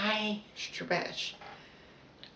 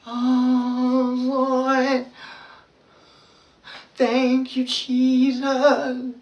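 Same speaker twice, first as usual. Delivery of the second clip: louder prayerful voice; prayer demo; 5 semitones higher, 8 dB louder, much slower than usual. Shown as a demonstration. Showing viewers the prayer she says while stretching, fervent. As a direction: lift it up like a heartfelt prayer. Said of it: "while you're doing that".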